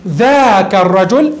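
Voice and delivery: ذاك الرجل spelled out slowly, each word held; deliberate classroom example; slow example phrase. Shown as a demonstration. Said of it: "إما مجردة من اللام نحو".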